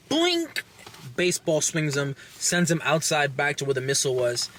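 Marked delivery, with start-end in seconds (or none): 0.1-0.6 high squeaky boink sound effect; cartoon bonk. Shovel hitting the guy, squeaky cartoon boink. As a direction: make the comic hit noise, pitched way up.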